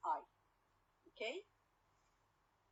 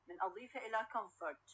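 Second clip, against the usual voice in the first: usual speaking voice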